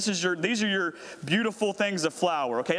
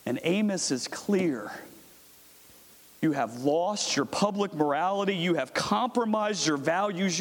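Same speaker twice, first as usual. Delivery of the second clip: ordinary talk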